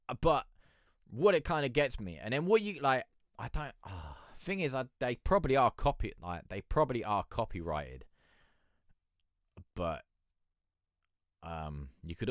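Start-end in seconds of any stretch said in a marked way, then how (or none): none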